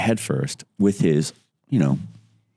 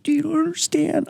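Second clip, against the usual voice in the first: very high, whiny mock voice; mock whiny plea. Roger stuck in the tree pleading with his teammate, mock whine. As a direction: plead like a hurt crybaby. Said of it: "And he's like".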